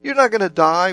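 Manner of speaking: protesting exclamation. Retold or imitated. Preacher re-quotes Peter's objection to Jesus, exclaiming in protest. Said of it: "his exclamation".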